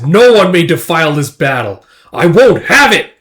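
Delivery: acted battlefield defiance, building in force; defiant outburst.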